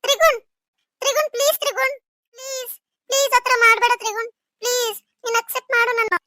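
high-pitched begging please; clinging, begging him not to refuse; desperate pleading. Acted. Shilpa begs Trigun in high-pitched desperation to accept her.

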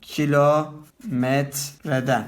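kilometreden said slowly; slow teacher's dictation of kilometreden; slow demo. Shown as a demonstration. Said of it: "Umarım anlamışsınızdır".

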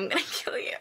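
laughing whisper of a fake threat; mock threat whispered. Teasing a fan who keeps saying the album name, laughing whisper.

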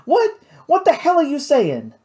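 high, loud, furious cursing; young man cursing at his girl, angry; angry outburst. Acted. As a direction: snap at her in disbelief. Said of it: "cursed and pounded his fist".

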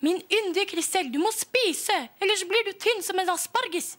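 high, loud pleading mother; mother pleading with her fussy daughter, anxious and loud; despairing mother. Acted. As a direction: beg the child to eat, half-desperate. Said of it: "mora ble fortvilet".

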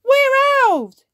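very high, slow, stretched proud cheer; the elf boys cheer proudly. Acted. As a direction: crow it out proudly. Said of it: "cheer Ben and Barnaby proudly".

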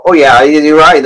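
usual speaking voice

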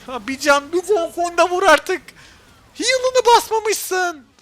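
very high, drawn-out, slow wail; exasperated whine. Real, not acted. Streamer wails at teammate who forgot heal, high slow whine. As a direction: stretch every word out in disbelief.

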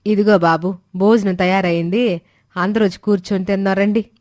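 plain reading voice

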